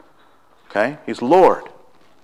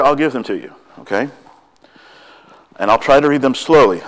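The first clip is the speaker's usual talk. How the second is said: usual speaking voice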